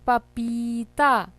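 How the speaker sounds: पपीता slowly with a long "ee" in पी; sounded out. Shown as a demonstration. Teacher naming the picture for children, पपीता slow and stretched. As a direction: draw out पी and ता in पपीता. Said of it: "पा में ई की मात्रा पी".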